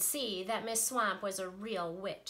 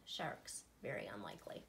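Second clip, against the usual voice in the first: dry aside; 12 semitones lower, 9 dB quieter than usual. Real, not acted.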